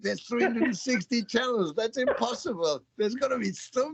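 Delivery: high, exasperated disbelief; mock exasperated parent. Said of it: "It's like".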